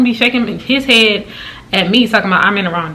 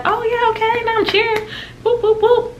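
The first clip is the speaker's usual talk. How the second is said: much higher, squealy whoop whoop cheer; mock cheering. Recalling the end of the second quarter, much higher mock cheering. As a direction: play it like a fan getting into the game. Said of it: "It was like".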